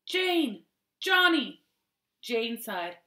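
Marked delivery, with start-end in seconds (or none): 0.1-1.6 higher, louder call across the street. A narrator's reading. Mother calling her children back from the street. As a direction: shout their names to find them.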